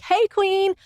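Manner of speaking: very high, fun performer greeting; high-pitched show of excitement; put-on happy excitement. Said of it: "which is fun and excited and happy".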